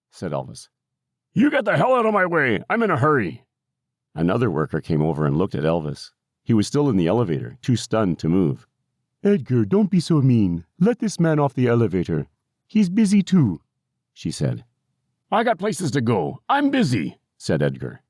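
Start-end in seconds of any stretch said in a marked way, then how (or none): 1.3-3.4 very high, strained character shout; escalated cranky yell, pitched way up; angrier old man's shout. A narrator's reading. Old man repeats his shouted order. 9.2-13.6 higher-pitched, firm worker voice; lightly acted scolding, rising in pitch; scolding care worker. A narrator's reading. 15.3-17.2 high, protesting character voice; indignant old resident, high-pitched; cranky old man. A narrator's reading.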